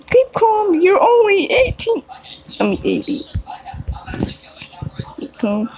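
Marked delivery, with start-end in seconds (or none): 0.1-2.0 mock crying shout. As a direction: wail it like a melodramatic meltdown. Mock shrieking the meme line.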